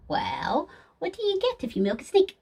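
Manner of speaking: ordinary talk, nothing marked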